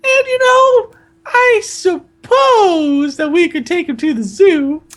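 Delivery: very high, drawn-out falsetto; slow Aunt Petunia. Acted. Aunt repeating the zoo idea, slow high falsetto. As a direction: stall in a slow, piping girl voice. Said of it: "said Aunt Petunia slowly".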